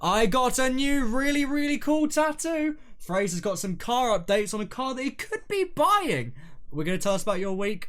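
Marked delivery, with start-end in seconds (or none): 0.0-6.3 high, slow, loud trailer voice; hype announcer. Acted. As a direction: sell the episode like a promo. Podcast trailer intro.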